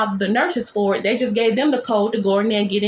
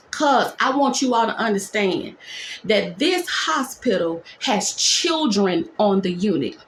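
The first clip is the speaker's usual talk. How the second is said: ordinary talk, nothing marked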